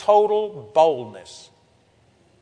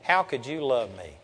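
retold despair; 5 semitones lower, 5 dB quieter than usual. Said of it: "I got so disappointed with myself".

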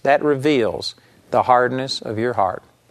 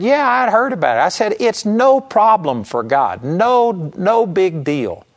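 breezy reassurance; 6 semitones higher than usual. Retold or imitated. Retelling his confident breezy reply.

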